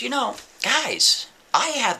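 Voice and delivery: mock-casual, stretched out high; smug drawl. Prisoner answering good cop bad cop, high slow smug drawl. Prisoner addressing officers smugly.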